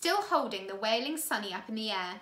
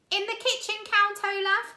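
put-on higher, louder boy's call; 10 semitones higher, 6 dB louder than usual. A narrator's reading. Klaus calling to Count Olaf from the kitchen, raised voice. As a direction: call it across the house. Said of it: "Klaus called".

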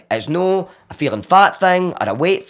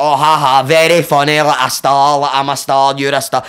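loud mock laugh and jeer; acted-out scornful comeback; sarcastic; 5 dB louder than usual. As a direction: push out a fake ha-ha, unamused.

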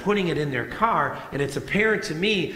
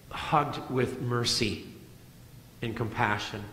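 tender hush; 5 semitones lower, 7 dB quieter than usual. Real, not acted. Preacher softening on God's mercy.

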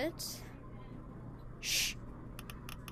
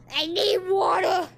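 loud panicked call for water; urgent shout; 4 semitones higher, 10 dB louder than usual. Real, not acted. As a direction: yell it like your mouth is burning. Just drank the blended smoothie.

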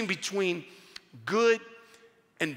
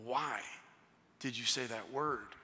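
low, hushed calming dad; soft, patient questioning; gentle soothing; 7 semitones lower, 11 dB quieter than usual. Retold or imitated. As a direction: ask very softly, with pauses, to calm a crying child. Father soothes crying son.

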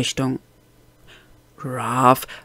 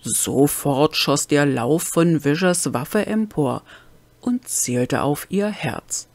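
usual speaking voice

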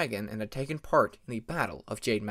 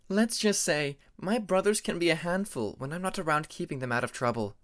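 put-on higher young dragoness voice, amused and fond; Tempest, fond sister; 4 semitones higher than usual. Tempest amusedly telling Peacemaker about her troublesome brothers, higher fond voice.

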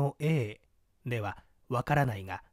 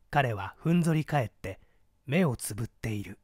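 usual speaking voice

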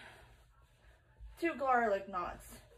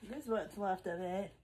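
usual speaking voice

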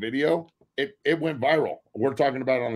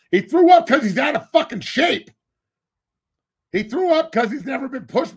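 heated rant; 9 semitones higher, 7 dB louder than usual. Real, not acted. Heated rant about soft player.

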